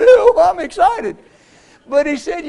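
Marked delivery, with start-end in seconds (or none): 0.1-1.2 high, loud, drawn-out tearful wail; imitated sobbing outburst; mock crying. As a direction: claim it while wailing as if in tears. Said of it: "they say you cry".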